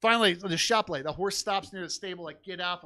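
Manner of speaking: usual speaking voice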